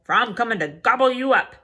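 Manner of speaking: loud, fast troll growl; troll threat. Acted. Troll threatening the little goat, loud and fast. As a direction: threaten to gobble him up.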